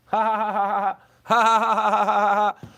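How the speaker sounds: loud, high fake cackle; mocking forced laugh. Jeering at a troll put on a list, loud fake laughter.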